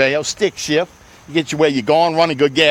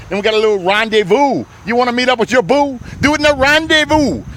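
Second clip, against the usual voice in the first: builds higher and louder into the rhyme; car salesman pumping up the crowd, climbing higher and louder; rising hype; 8 semitones higher, 4 dB louder than usual. Real, not acted. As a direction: sell the rhyme with climbing excitement. Hyping the car pitch.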